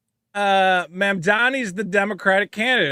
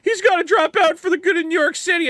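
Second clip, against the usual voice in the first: mock whiny Bill Ackman; 10 semitones higher than usual. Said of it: "Bill Ackman's going".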